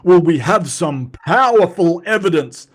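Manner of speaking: mocking Donnie's paper, a loud pompous quote; mock pompous claim. Mocking Donnie's paper wording, puffed-up and pompous. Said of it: "scientists don't go".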